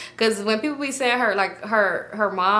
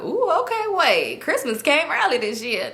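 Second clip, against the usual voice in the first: mock delight, starting very high; excited; 7 semitones higher than usual. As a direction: imitate onlookers thrilled by a windfall. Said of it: "so they getting excited".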